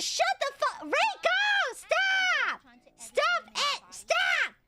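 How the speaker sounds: high, loud screamed protests; frantic yelling to make them quit; exasperated shouting. Real, not acted. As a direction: shriek each command, overwhelmed. Screaming at donors to stop.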